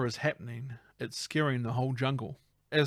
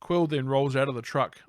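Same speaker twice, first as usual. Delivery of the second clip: ordinary talk, nothing marked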